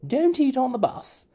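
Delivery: high loud mock teacher. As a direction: nag like a strict teacher. Mimicking teacher sayings.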